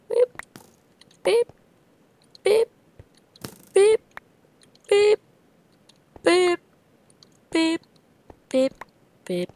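high loud mock beeping noise; high beeps. Gamer beeping playfully at his game, goofy. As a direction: beep like a little machine.

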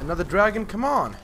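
high, loud frustrated outburst; exasperated yell. Real, not acted. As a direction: cry out in disbelief at another dragon. Annoyed at another dragon.

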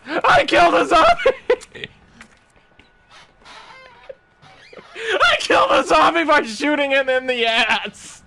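crowing over a lucky kill; excited laughing. Real, not acted. Streamer brags to chat about the zombie kill, laughing excitedly.

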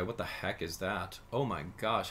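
ordinary talk

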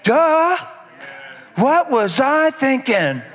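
slap your forehead, slow and silly; mock duh. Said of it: "repentance would just be like".

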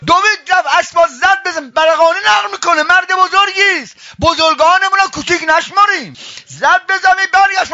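high, forceful storytelling at full pitch; impassioned preaching. A narrator's reading. Preacher tells of servant rushing for orphan, full-force narration.

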